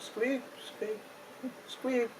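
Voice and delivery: rising into a high squeak; squeaking hides. Acted. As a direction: start plain then squeak high. Hides squeak under foot.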